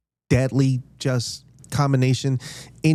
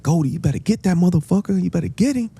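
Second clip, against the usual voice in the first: high, fast imitated egging on; urgent egging on; 7 semitones higher, faster than usual. Friend urging Goldie to get the guy, fast high egging on. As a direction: push your friend to handle it. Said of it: "he's like".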